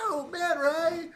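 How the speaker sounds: loud, very high cartoon cry; hammed-up shout to stop him; mock alarmed cry. Pleading with villain strangling Patrick, mock alarmed cry. Acted plea during retold strangling.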